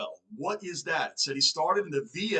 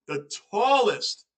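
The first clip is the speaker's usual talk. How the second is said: TALLEST drawn out slowly and pitched up; slow, higher punch on tallest; emphatic amazement; 5 semitones higher, 4 dB louder, slower than usual. Real, not acted. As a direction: stretch out the word tallest with a high swell. Amazed repeat of player's height.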